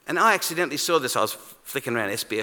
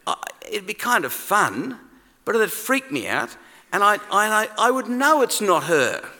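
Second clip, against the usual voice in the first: usual speaking voice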